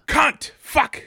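high, sudden pretend tic; mock Tourette's outburst. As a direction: blurt each swear on its own like a tic. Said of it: "and be screaming".

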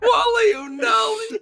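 very high, drawn-out squeal; slow high-pitched imitation of a kid; mimicking a kid. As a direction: squeal the mangled name like a kid.

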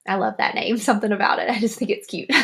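laughing. Real, not acted. Giggling about a cute name.

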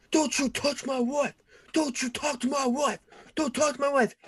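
high, hammed-up furious yelling; mock angry shouting. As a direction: bellow it like a jealous husband. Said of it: "so he's screaming, shouting".

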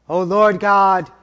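normal talking voice

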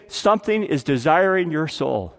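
ordinary talk

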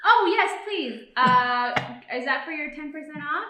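drive thru speaker. Pretend. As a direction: gush like a thrilled cashier. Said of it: "Here, we'll do it".

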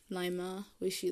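ordinary talk, nothing marked

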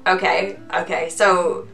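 ordinary delivery

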